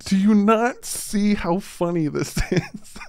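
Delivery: slow, giggling question; laughing. Real, not acted. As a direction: ask while cracking up. Laughing at critics.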